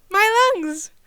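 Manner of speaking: high, loud, proud exclamation; beaming. A narrator's reading. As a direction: announce it with a huge grin. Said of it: "Danny beams".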